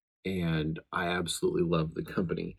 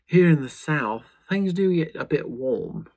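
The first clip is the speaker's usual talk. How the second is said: sudden hammed-up Southern accent, higher and louder; 8 semitones higher, 8 dB louder than usual. Vlogger joking about Southern heat in a put-on Southern accent. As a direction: drawl "here in the South" like a Southern local, slowly. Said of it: "I don't know where that accent came from".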